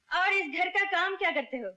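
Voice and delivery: stern scolding. Acted. Woman taking idle Ranjit to task, stern scolding. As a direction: interrogate him like a strict boss.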